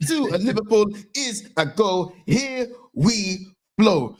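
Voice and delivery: put-on slow, drawn-out Italian reporter accent; mock Italian reporter accent; transfer announcer accent. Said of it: "You did the accent".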